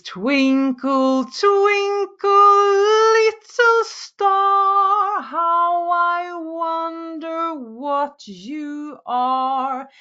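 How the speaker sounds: high, very slow, stretched syllables; prolonged syllables. Shown as a demonstration. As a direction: draw out each syllable almost singing. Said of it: "do like this".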